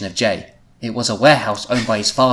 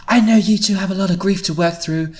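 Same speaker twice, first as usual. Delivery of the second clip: higher voiced, urgent appeal; earnest plea; 8 semitones higher, 4 dB louder than usual. A narrator's reading. Louise to the two grieving friends, earnest plea. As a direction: press them to act, voice raised in pitch.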